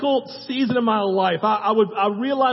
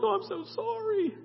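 high, much softer, sugary apology; apologetic mom; 11 semitones higher, 9 dB quieter than usual. Retold or imitated. Imitating the polite mom saying sorry to the other parent, high and soft. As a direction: play the mom smoothing it over, up high and hushed. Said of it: "The mom's like".

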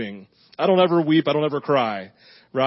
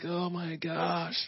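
much softer, slow, breathy dread; fearful oh my gosh; 3 semitones higher, 14 dB quieter, slower than usual. Retold or imitated. Pastor recalling the fear about his daughter, hushed and slow. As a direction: let it fall out of you, hushed and scared.

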